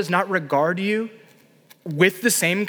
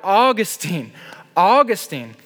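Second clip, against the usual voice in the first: calling out his name, louder; woman calling after him, louder; 3 semitones higher, 4 dB louder, slower than usual. Retold or imitated. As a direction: call after him more insistently. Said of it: "She kept saying all the louder".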